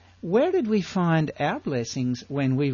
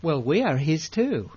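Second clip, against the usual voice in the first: ordinary delivery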